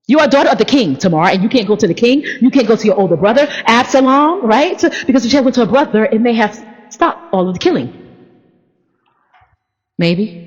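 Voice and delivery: ordinary talk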